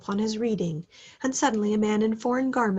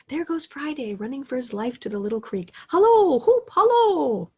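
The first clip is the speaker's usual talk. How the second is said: high, loud hallo building to a whoop; calling out; 6 semitones higher, 6 dB louder than usual. A narrator's reading. Scrooge hails Friday running to the creek, loud whooping call. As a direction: shout after a running figure.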